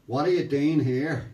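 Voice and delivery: loud, slow Northern Irish demand; thick Northern Irish accent. Retold or imitated. Policeman at the car window, thick Northern Irish accent. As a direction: bark it in broad Northern Irish. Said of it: "he says in his thick Northern Ireland accent".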